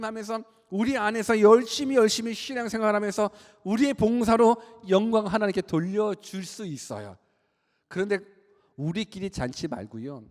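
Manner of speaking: high, loud pulpit delivery; forceful sermon voice, stretched at the end; impassioned preaching. Acted. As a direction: drive it home to the congregation.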